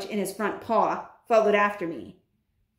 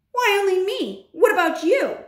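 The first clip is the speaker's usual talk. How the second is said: high, louder, affronted questions; indignant retort; 10 semitones higher, 4 dB louder than usual. Acted. Bruno to friend about parents' reaction, indignant retort. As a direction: turn it back on the other, pitched up. Bruno objects to being singled out.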